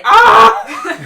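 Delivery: very loud, high-pitched mock scream; sudden full-force comic shriek; imitated scream. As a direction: scream it over the top, for laughs. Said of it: "But Joshua is going".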